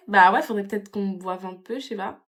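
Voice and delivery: usual speaking voice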